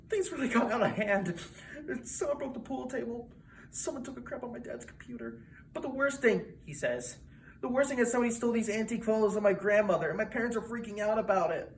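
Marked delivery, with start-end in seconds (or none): 0.1-6.4 high, soft, pained voice; imitating a distraught kid, pitched up; upset teenage host. Upset host retells party damage. 7.6-11.7 fast, high, pained voice; imitating a distraught kid confiding; upset teenage host. Upset host retells stolen photos.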